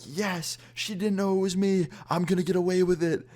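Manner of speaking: high, gleeful relief; gleeful inner cheer. Retold or imitated. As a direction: cheer inside your head, overjoyed to get away. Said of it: "I'm just screaming to myself".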